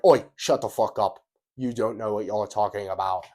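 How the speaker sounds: loud British barking Oi, then settling; hammed-up rude British tough guy; mock Andrew Tate British snap. Imitating Andrew Tate cutting Ashton off, loud British accent. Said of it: "He'd be like".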